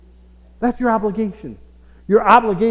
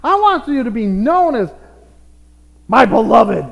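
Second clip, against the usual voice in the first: pitched up and louder, preaching it out; loud proclamation; 5 semitones higher, 8 dB louder than usual. Real, not acted. Preacher declaring God's love to the church, ringing loud. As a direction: call it out over the congregation.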